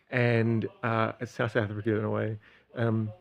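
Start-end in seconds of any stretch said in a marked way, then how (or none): none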